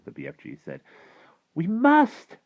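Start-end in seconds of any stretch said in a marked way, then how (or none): 1.6-2.4 very high, loud demand; high, forceful plea; insistent cry. A narrator's reading. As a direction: cry it out urgently as a young girl. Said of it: "Sophie cried".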